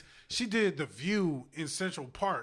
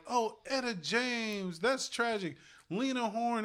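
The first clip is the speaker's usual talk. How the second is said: higher, drawn-out imitation of mourners; mock lamenting fans; 7 semitones higher, slower than usual. Imitating people mourning aging singers, mock lament. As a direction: mimic people lamenting, pitched up. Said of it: "people are like".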